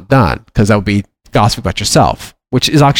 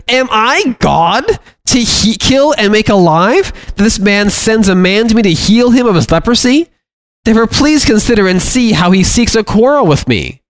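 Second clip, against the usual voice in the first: king's distressed outcry; 9 semitones higher than usual. Acted. King tears clothes, exclaims.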